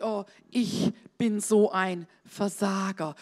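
low, slow self-pitying moan; mock despondent self-talk; enemy posing as self. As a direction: drag it out like a dejected inner voice. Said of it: "Er sagt".

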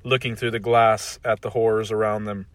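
plain reading voice

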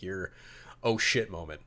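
usual speaking voice

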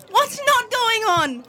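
high, drawn-out exasperated retort. Acted. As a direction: throw the question back in exasperation. Acted exasperated reply to Charlie.

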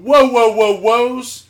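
high, loud run of whoas; mock someone loudly cutting in; imitated holler to stop; loud halting whoas. Imitates the stream of interruptions.

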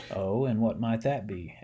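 plain reading voice

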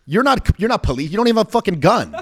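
high, loud, fast scoff; retold shout. To undercover cops, fast loud disbelief. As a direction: rattle off the accusation loud. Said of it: "I'm like".

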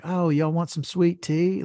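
mock Southern hospitality, mild; Southern accent. Podcast host mimicking the son's Southern accent, lightly. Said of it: "the accent".

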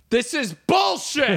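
high, drawn-out protest yell; mock indignant yelling. Plane passengers all start yelling, indignant. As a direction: cry out in comic outrage. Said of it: "And they all just start yelling".

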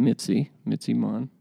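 usual speaking voice

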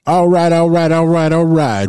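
loud, high show opener; hype host. Real, not acted. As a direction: open the show with energy.

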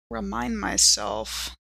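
ordinary delivery

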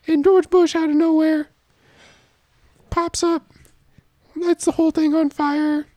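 very high falsetto storyteller; high character voice. Acted. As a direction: tell the story in a squeaky falsetto.

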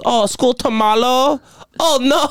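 mocking Chinese voice feigning sympathy; mock Chinese accent. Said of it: "He goes".

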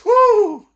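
a high, loud whoop with a laugh; excited whoo. Real, not acted. Whooping as he remembers the eighties Lakers–Celtics rivalry. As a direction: let out a delighted whoop.

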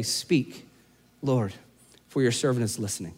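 a quiet personal prayer to God; soft prayer. Shown as a demonstration. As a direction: breathe it out like a whispered prayer. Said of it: "added to your soundtrack".

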